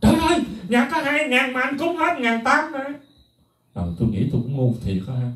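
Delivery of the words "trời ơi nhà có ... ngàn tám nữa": high, wailing wife imitation; wife's wailing. Monk imitating his wife wailing over the donated money. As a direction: act out the wife crying out in dismay. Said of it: "Bả la làng".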